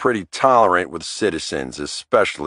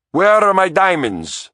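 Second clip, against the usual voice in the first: put-on Italian accent, pitched much higher and demanding; thick Italian accent; 11 semitones higher, 3 dB louder than usual. A narrator's reading. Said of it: "in a thick Italian accent".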